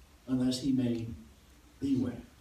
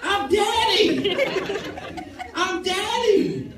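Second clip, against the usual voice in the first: much higher, louder, laughing protest; laughing exasperation; 12 semitones higher, 4 dB louder than usual. Retold or imitated.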